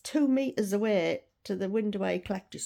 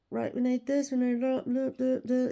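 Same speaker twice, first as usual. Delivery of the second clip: imitating busy counter staff, slightly higher; staff calling orders; 4 semitones higher than usual. Imitating staff over the counter din, mock order-calling. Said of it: "shouting out the orders".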